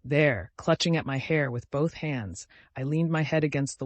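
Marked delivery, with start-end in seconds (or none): none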